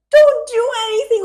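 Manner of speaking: high-pitched urgent plea; panicked warning. Retold or imitated. Warning a friend with the caller on the line, panicked.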